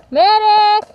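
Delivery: man down shout. Real, not acted. Hit, calling for a medic.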